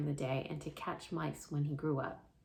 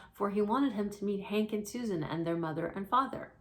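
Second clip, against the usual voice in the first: ordinary delivery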